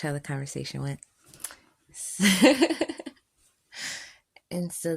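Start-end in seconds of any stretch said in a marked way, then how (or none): 2.0-3.1 loud high giggling laugh; high cackle. Real, not acted. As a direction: shriek with laughter. Laughs at own story.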